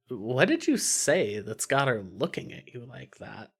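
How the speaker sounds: ordinary delivery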